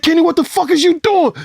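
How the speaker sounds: way up high, alarmed; panicked yell. Retold or imitated. Yelling at Kenny as he guns the car, panicked. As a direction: shout at the driver in a panic. Yelling at driver during shooting.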